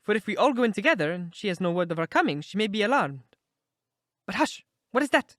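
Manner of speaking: high, agitated, quick character voice; nervous little man. A narrator's reading.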